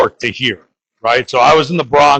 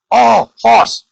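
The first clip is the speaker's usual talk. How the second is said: mock call; 9 semitones higher, 3 dB louder than usual. Mock cry of the cop.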